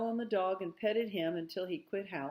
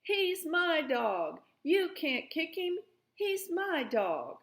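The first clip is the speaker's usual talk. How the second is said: put-on yelling child; 10 semitones higher, slower than usual. A narrator's reading. Little Arliss yelling at Mama over the dog, high and slow. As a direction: wail it like a tearful little boy. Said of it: "He began to yell".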